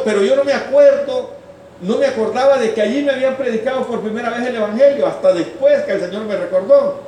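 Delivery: loud, high-pitched pulpit proclamation; full-force preaching. Acted. Preacher telling the brethren where God spoke, full-force preaching. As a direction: drive it out loud and high to the congregation.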